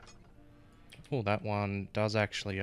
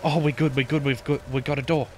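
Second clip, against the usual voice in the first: being chased; 5 semitones higher, 8 dB louder, faster than usual. Real, not acted. Relief after escaping.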